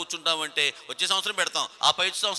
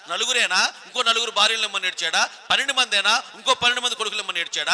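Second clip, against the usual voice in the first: ordinary talk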